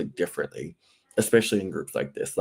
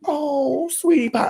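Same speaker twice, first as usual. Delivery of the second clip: very high, mock-sugary coo; saccharine sweet-talk; 5 dB louder, slower than usual. Host mocking polite reality TV talk, syrupy sweet. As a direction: put on a fake sweet little voice. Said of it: "I'm not going to, like, do, like, the".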